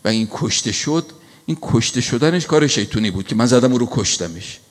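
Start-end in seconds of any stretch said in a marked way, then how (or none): none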